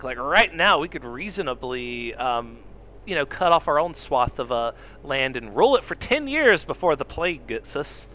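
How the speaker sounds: ordinary talk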